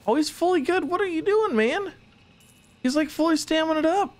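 pitched high with disbelief at the rested worker; squeaky excited. Real, not acted. Streamer gushing at his game worker, high and excited. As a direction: jump way up into a high squeaky register.

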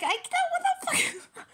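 very high squeal collapsing into laughter; imitated retort lost in giggles; laughing. Reader mimicking Virgo's refusal to soften, laughing.

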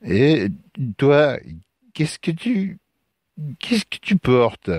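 put-on high, halting drunk young man; hesitant voice. Drunk stranger questions Lucas about his burden, hesitant. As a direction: call out haltingly, stumbling over the question. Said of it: "une voix hésitante".

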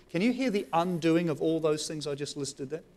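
ordinary talk

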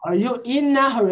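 "in a" run together as "inna"; linked words. Shown as a demonstration. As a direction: glide from "in" straight into "a". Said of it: "Eu juntei". ("in a" emendado numa palavra só)

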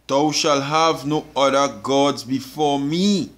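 slow, weighty, word-by-word scripture; solemn recitation. Acted. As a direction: lay each word down slowly.

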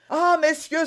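high, loud, desperate outcry; panicked cry. A narrator's reading. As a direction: burst in crying for help. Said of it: "s'écria-t-il". (cri affolé)

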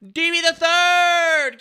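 stretched slow and loud, announcer-style; drawn-out hype call. Real, not acted. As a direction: call the name out like a ring announcer. Streamer hyping a gift giver.

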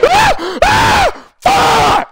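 high startled screams; terrified scream. Real, not acted. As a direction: scream in real fright. Jump scare in horror game.

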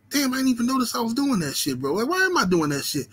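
high, startled self-realisation; sudden realisation. Retold or imitated. Streamer realising he faked a reporter voice, high and startled. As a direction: catch yourself mid-habit, amazed.

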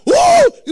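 very high loud whoop; mimicking a shouting church member; woo shout. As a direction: let out a worshipper's whoop. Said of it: "Person shout".

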